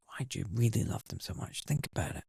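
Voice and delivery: quiet probing voice from within; inner voice. Retold or imitated. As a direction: ask it softly, like a voice inside your head. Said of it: "It's like".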